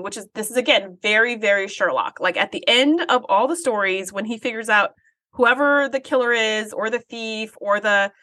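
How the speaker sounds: ordinary delivery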